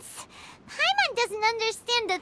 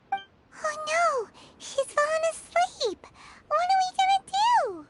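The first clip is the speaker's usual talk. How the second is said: panicked; 7 semitones higher than usual. Real, not acted. Paimon panics, Kokomi asleep.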